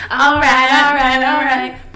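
loud hype-up call; hype man shout. Acted. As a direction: pump the crowd up loudly. Hyping up to rap.

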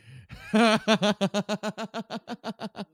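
big loud laugh that almost shouts. Real, not acted. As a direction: burst out laughing at the interruption. Roommate walks into recording.

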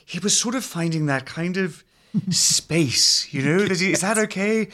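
hesitant, halting documentary interviewer impression; Louis Theroux. Explaining how the voice was unlocked, as a tentative Louis Theroux. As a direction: feel for the space, querying lightly. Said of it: "Well, with Louis".

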